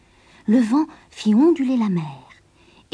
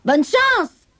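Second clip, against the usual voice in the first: high, raised sailors' farewell shout; called out; 10 semitones higher, 4 dB louder than usual. A narrator's reading. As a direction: shout a goodbye across the water. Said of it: "Les marins lui criaient".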